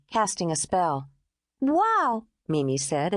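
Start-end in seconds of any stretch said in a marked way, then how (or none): 1.6-2.2 put-on impressed little girl gasp; delighted, awed child; admiring wow. A narrator's reading. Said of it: "admiring the artwork".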